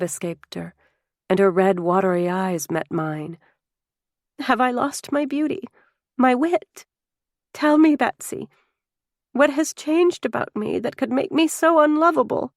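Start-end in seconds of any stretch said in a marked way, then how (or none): 4.4-12.5 higher, pleading, near tears; rising anguished questions to her sister; anguished plea. A narrator's reading. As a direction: beg to be told what went wrong.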